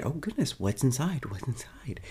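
high, hushed excitement fading to a whisper; mock-excited curiosity, sinking into a whispered repeat. [name] asking about the delivered crate, playfully excited. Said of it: "[name]'s just excited".

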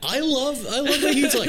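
very high excited rush; excited squeal. Real, not acted. Fan jumping in about Jabba, high excited squeal. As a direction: squeal it with delight.

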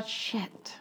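a quiet unvoiced curse; whispered curse. Real, not acted. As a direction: whisper it under your breath. Whispered curse while thinking.

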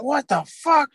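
high mock-outraged screech; angry mom. Mom confronting son next morning, outraged screech.

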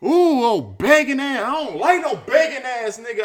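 high, loud mocking jeer; loud, high-pitched scornful jab; retold loud put-down. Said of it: "What I said out loud".

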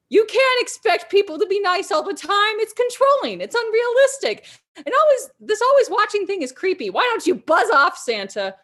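high, loud defiant outburst; angry rant. Acted. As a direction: tell him off in fury. Kaylin's rant at Santa.

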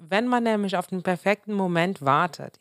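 usual speaking voice

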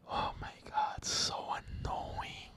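unvoiced, fed-up whisper; slow down and breathe it out in disgust; exasperated whisper. Real, not acted. Venting to viewers about cancel culture, exasperated whisper.

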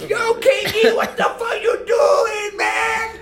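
high, slow stretched shout; Adam Sandler yell. Retold or imitated. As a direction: scream at KG like Sandler. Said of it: "me voy a ir a Saturday Night Live".

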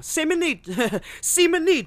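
mock Italian greeting, overdone; bad Italian accent gag. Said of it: "bad Italian fucking accent jokes".

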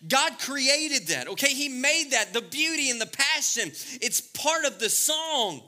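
full-force preacher; preaching. Acted. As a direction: build it like a pulpit peak. Preaching climax.

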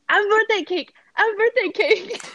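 put-on high, eager little-boy blurt; toddler voice. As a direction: pipe it up like a tiny kid bursting out. Mom imitating son again.

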